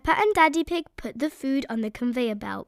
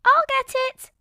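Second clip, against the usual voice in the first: high loud cry; eager cry; 13 semitones higher, 6 dB louder than usual. A narrator's reading. Peppa volunteering for fruit, high. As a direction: dash in eagerly. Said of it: "cried Peppa".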